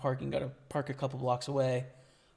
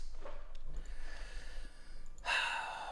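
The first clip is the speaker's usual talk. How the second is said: weary sigh; 5 dB quieter than usual. Real, not acted. After losing the hand.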